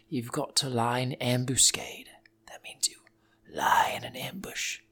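hushed, conspiratorial whisper; soft secret whisper, slowing down at the end; sneaky whisper. Pretend. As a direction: whisper like you're hiding in the bushes. Lying in ambush for a taco.

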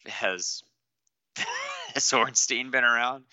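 laughing through a teasing question; joking question. Retold or imitated. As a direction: crack up while asking, as a joke. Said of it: "I started joking around".

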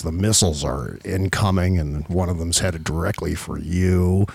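deep, droning passive-aggressive politician; Bill Barr. Acted. Host as Barr reading an apocalypse alert, deep passive-aggressive drone. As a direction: announce doom in a low, unbothered monotone. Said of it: "it's gonna be Bill Barr saying".

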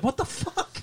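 high, half-laughing what the fuck; shocked disbelief. Retold or imitated. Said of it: "I'm like".

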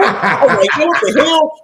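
high, laughing disbelief; cracking up while asking what the hell. Real, not acted. Cracking up retelling the coach's mean mugging, laughing.

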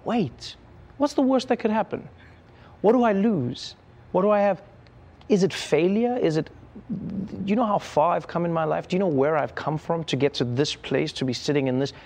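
starts high and urgent, settles down; building self-pep talk. Retold or imitated. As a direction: fire yourself up, then calm to a vow. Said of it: "I went".

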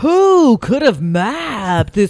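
slow, loud mystery narrator; dramatic narration. Pretend. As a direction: drag it out like a documentary.

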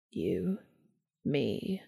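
Castiel's low, seductive rasp; deep rough voice. A narrator's reading. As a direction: let it sink deep and rough like sandpaper. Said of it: "Castiel's voice was deep and rough like sandpaper".

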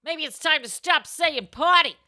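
high, loud "Hey, Gladys"; loudly calling out a friend at dinner. Acted. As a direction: call her out loud across the table. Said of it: "you say".

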